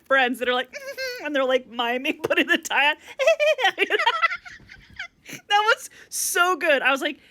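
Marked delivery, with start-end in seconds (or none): 3.0-4.5 helpless high-pitched laughter. Real, not acted. Laughing at tie scene. 5.3-6.8 laughing. Real, not acted. Laughing at tie scene.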